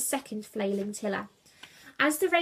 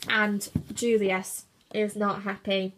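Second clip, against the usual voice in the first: plain reading voice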